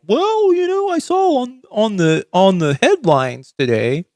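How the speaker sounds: high squeaky mock voice of a know-it-all; a high, ditzy parody of a clueless viewer. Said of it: "they all kind of fucking do this thing of like".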